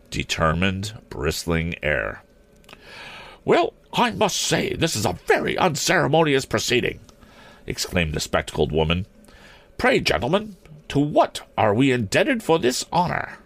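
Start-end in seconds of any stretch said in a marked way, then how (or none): 3.5-7.0 high-pitched voice of an offended schoolmistress; indignant matron. A narrator's reading. Elderly principal confronts men on her roof, indignant. 9.8-13.4 high-pitched voice of an offended schoolmistress; indignant matron. A narrator's reading. Elderly principal confronts men on her roof, indignant. Matron coldly questions the intruders.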